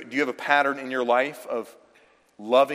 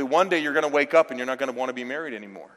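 normal talking voice

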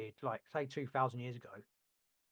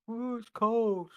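slow high whine; drawn-out mocking whine; whiny kid voice; 9 semitones higher, 6 dB louder, much slower than usual. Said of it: "young sponging adults are like".

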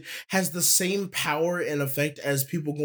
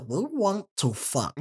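alien voice; 3 semitones higher than usual. Retold or imitated. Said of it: "whispered to me".

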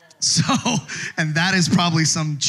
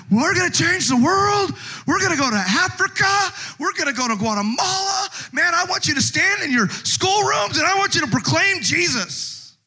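mock-shouted big promises; hyped young pastor; 13 semitones higher than usual. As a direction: fire up the crowd like a zealous young preacher. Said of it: "in my younger pastoral days".

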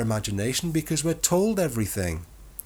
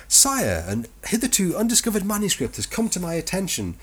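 mock courtier; 9 semitones higher than usual. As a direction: announce it like a pompous courtier. Mock archival discovery announcement.

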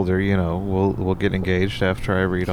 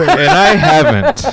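squeaky joke; 7 dB louder, slower than usual. Acted. Joking high squeak.

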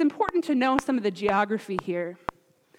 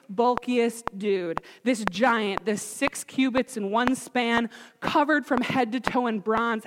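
normal talking voice

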